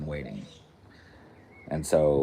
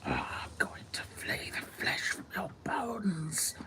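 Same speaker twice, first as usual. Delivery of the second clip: menacing breathy whisper, slow; Voldemort whisper; 13 semitones higher, 7 dB quieter, slower than usual. Retold or imitated. Voldemort threatening Pauly Shore, in a sinister whisper. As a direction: hiss it like a dark wizard. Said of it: "Voldemort replies again".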